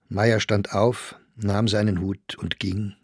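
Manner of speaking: plain reading voice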